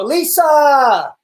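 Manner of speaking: loud, high call across the room. Real, not acted. Host calls his wife loudly mid-stream. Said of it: "guys, I'm yelling".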